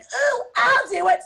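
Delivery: high, loud, eager little voice; eager Eve. Retold or imitated. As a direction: squeal it like an overeager girl. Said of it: "And she was like".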